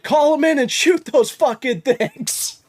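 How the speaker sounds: high, urgent holler; retold yell. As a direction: yell it high to nearby hunters. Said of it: "And I just yell".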